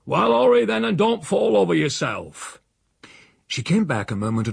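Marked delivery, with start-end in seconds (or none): 0.0-2.6 high, loud hollering, easing off; impatient shout. A narrator's reading. Uncle hurries the housekeeper along, shouting impatiently. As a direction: bawl at someone to hurry.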